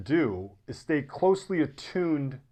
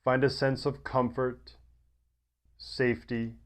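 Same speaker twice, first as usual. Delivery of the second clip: guided meditation; 4 semitones lower than usual. Acted. Yoga teacher settling listeners into deep rest, guided meditation. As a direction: ease them gently into rest, low and unhurried.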